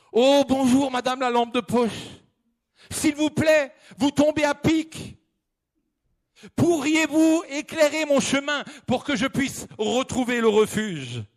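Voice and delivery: high, eager pleading voice; pleading hiker. Acted. The lost hiker pleading with the flashlight for light. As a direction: beg politely, relieved to find help.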